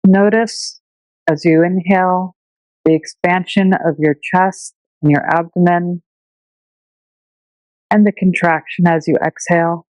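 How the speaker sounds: unhurried meditation teacher voice with long pauses; calm, measured breathing-meditation delivery; guided meditation. Shown as a demonstration. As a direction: guide listeners gently through their breath, leaving space between phrases.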